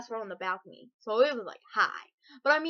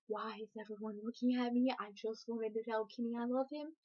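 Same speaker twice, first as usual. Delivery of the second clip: softer, sheepish inner thought; embarrassed murmur; 9 dB quieter than usual. Retold or imitated. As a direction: say it quietly, embarrassed.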